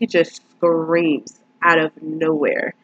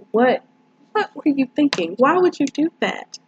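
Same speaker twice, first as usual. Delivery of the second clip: high-pitched, baffled reproach; incredulous; 8 semitones higher than usual. Real, not acted. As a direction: question someone's senseless decision in disbelief. Incredulous at character's foolish act.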